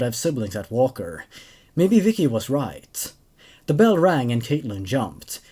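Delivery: steady, even storytelling read; story narration. A narrator's reading. Host reading a creepypasta to his viewers, calm narration. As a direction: carry the tale along calmly.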